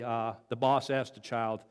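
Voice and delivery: ordinary talk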